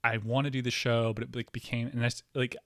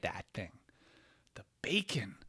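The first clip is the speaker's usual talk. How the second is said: hushed whisper over the bacon; whispered awe; 4 semitones lower, 9 dB quieter than usual. Real, not acted. Host whispering in awe at the burger photo. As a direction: breathe it out in awe. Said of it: "admiring burger photo".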